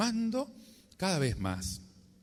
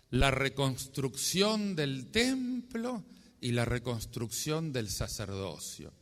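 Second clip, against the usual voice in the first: usual speaking voice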